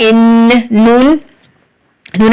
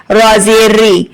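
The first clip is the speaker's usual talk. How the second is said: usual speaking voice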